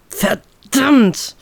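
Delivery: a touch of a hissed swear through clenched teeth; curse through gritted teeth. Acted. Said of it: "fluche ich zwischen zusammengepressten Zähnen". (leicht gepresster Fluch durch die Zähne)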